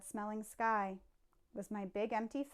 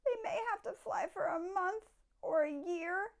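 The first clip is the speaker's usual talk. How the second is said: Lorax, coughing; 9 semitones higher than usual. A narrator's reading. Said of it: "said the Lorax".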